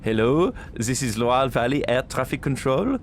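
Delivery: playing a French air traffic controller with a French accent. Controller answering the stranded pilots' call, French accent. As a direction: put on a French accent for the tower. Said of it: "I got a French degree".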